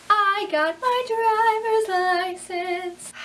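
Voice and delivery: sung out high and loud; excited. Real, not acted. Girl announcing her new license to viewers, sung out high. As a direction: sing it out like big news.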